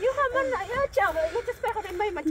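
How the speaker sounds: normal talking voice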